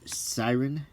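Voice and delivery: usual speaking voice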